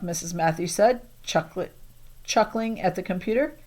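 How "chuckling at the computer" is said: plain reading voice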